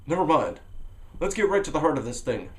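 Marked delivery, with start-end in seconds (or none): none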